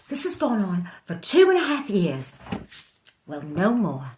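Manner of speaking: loud, high declaration of enough; fed-up, defiant shout; defiant outburst. Acted. Fighter outburst in monologue.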